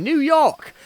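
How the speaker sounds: badly faked New York accent; hammy attempt at New York; terrible New York accent. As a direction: drop a clumsy New York accent onto the name. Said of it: "that was a terrible New York accent".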